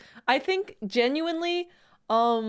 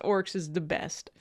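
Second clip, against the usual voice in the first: low orc mumble; 8 semitones lower, 6 dB quieter than usual. Pretend. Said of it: "green is the best".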